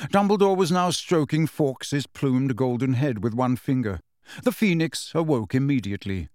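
usual speaking voice

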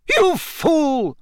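high-pitched, outraged cry; sharp rise into indignation; angry cry. A narrator's reading. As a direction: cry it out in fury. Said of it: "Professor McGonagall cried".

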